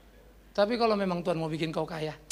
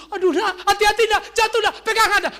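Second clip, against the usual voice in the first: high, loud mock motherly panic; hammed-up anxious mum voice; panicking mothers; 12 semitones higher, 7 dB louder than usual. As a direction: cry out warnings like a fretting mother. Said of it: "jangan kayak ibu-ibu".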